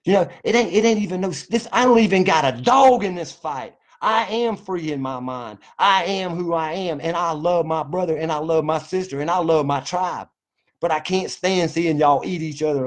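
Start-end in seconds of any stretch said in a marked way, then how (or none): none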